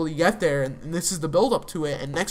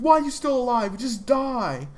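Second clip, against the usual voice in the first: higher-pitched mock yell of a furious son; retold angry yelling; 7 semitones higher than usual. Son yelling at his father over the phone, retold angry yell. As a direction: voice the son's outburst, pitched up and heated. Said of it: "yells at Walt".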